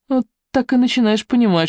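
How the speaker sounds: usual speaking voice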